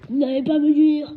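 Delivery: loud roaring giant voice; furious giant roaring. A narrator's reading. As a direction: bellow it at the Dursleys. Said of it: "rugit Hagrid".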